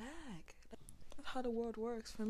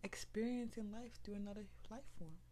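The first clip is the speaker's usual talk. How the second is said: usual speaking voice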